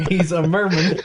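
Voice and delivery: laughing as it sinks in. Real, not acted. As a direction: giggle the words out. Laughing repeat of the reveal.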